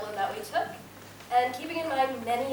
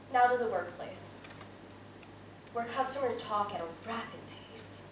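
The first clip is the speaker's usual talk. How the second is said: usual speaking voice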